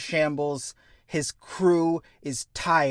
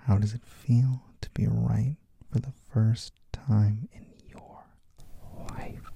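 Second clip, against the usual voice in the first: slow, menacing whisper; villain whispering to the captain; whisper in ear; 4 semitones lower than usual. Retold or imitated. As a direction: whisper it right into his ear. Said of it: "gets up right up to his ear".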